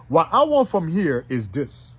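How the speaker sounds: ordinary talk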